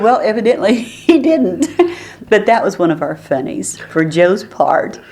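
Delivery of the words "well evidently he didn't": laughing through the punchline; high and giggly, can't hold it in. Real, not acted.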